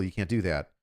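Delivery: low, quiet, matter-of-fact; flat German rule reply. Retold or imitated. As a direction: state the rule like it's obvious. German voiced in retold quarrel.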